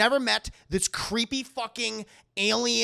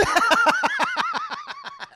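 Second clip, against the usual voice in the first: high laugh; 8 dB louder than usual. Real, not acted. Laughs at chat.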